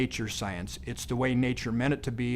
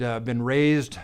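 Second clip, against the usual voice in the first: ordinary delivery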